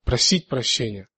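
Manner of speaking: usual speaking voice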